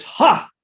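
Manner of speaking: sharp high triumphant ha; scoffing ha. Retold or imitated. Prabhupada scoffing at the useless-stove excuse: high triumphant ha. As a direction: bark a short mocking ha.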